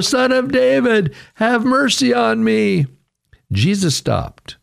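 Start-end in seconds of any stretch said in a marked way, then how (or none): 0.0-2.9 high, desperate plea; shouting. A narrator's reading. Blind beggar shouting past those rebuking him, high plea. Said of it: "he shouted all the more".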